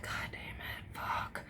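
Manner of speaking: soft whispered swear; whispered curse. Retold or imitated. Teen whispers a curse as friend admits drinking. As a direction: curse under your breath. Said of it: "And I was like".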